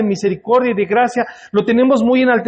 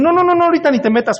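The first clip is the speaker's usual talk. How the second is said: fast, high, flustered refusal; agitated brush-off; 9 semitones higher, faster than usual. Retold or imitated. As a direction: wave the pastor off in a rush. Church member brushing off pastor.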